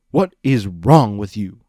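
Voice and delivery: loud furious yell; furious at the friend who wronged him; angry shout. Acted. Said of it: "he shouted at Discord".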